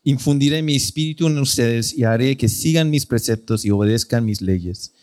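a measured, solemn reading of the verse; solemn Bible reading; scripture reading. Acted. Reads scripture aloud.

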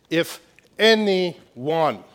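"anyone" stretched out very slowly, loud and high; emphatic ANYONE. Real, not acted. Preacher telling the congregation it is not just special people, emphatic on "anyone". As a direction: hammer "anyone", drawing out each syllable.

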